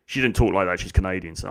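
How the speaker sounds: ordinary delivery, nothing marked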